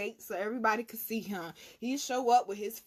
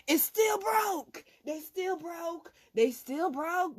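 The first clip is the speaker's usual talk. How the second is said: slow emphatic punchline; emphatic slow stress; 7 semitones higher, 3 dB louder, slower than usual. Real, not acted. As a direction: still punched high, slow and loud.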